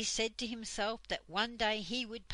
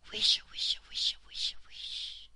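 hushed rustle of trees, all whisper; 4 dB louder than usual. A narrator's reading. Trees talking secretly to one another, whispered. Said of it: "trees whispering secretly to one another".

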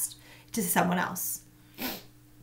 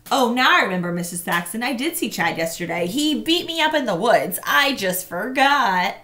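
mock confession; 3 semitones higher, 6 dB louder than usual. Marshall mocks a fake confession.

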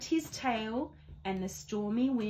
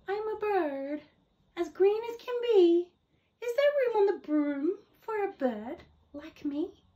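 a touch higher and louder, a chirpy bird; lightly voiced bird; 8 semitones higher, 4 dB louder than usual. Bird asking the witch for a ride, lightly chirpy. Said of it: "then said".

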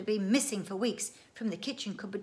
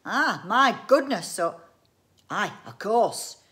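louder, drawn-out exclaiming; acted exclamation; 3 semitones higher, 10 dB louder, slower than usual. Inventor exclaims to himself, loud and drawn out. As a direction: exclaim it slowly and loudly.

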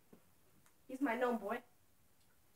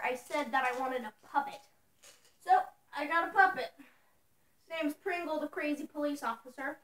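ordinary talk, nothing marked

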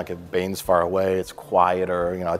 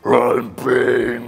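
louder growled villain line; evil monster voice; 3 semitones higher, 6 dB louder than usual. Retold or imitated. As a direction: boom out a movie villain. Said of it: "sound like a really evil monster".

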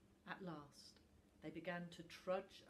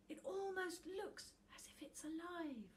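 put-on much higher, wondering boy; awed child; 12 semitones higher than usual. A narrator's reading. Little Tuft marvels at the dragon picture, wondering.

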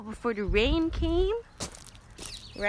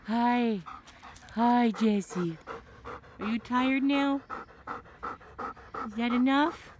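normal talking voice

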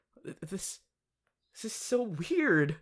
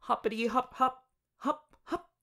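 light, higher sing-song hopping sounds; playful bunny; 6 semitones higher than usual. Pretend. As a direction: play at being a bunny.

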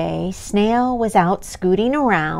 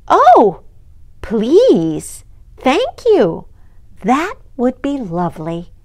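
delighted; 4 semitones higher, 7 dB louder than usual. Acted. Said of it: "said the ants".

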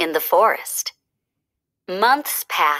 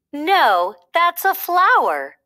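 high, bright correction; bunny correcting his friend; 8 semitones higher, slower than usual. A narrator's reading. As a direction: gently set him right, pitched up. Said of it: "correcting him again".

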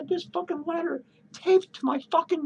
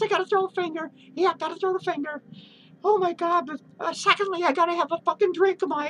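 ordinary talk, nothing marked

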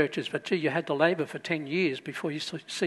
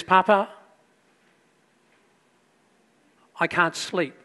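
little daughter; 2 semitones higher than usual. Retold or imitated. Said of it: "a little daughter runs into him and says".

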